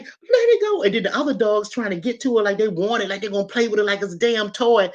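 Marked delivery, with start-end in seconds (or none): 0.0-0.8 high yelled command; yelling. Retold or imitated. Yelling at the dog holding a mouse, high shriek. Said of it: "And I'm like".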